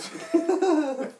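high, hammed-up villain cackle; evil laugh. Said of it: "I laughed evilly".

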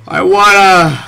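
sudden high loud cry; startled yelp. Real, not acted. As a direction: blurt it out in alarm.

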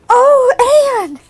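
high, loud gasping cry of relief; near-weeping gasp. A narrator's reading. Diana weeping with relief on her friend's neck. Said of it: "gasped Diana".

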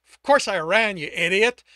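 very loud contemptuous yell; shouting the insult at his brother; angry shout. Acted. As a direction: hurl the insult at full volume. Insult at peak of rant.

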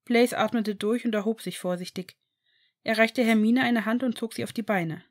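ordinary delivery

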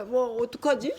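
plain reading voice

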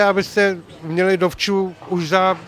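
usual speaking voice

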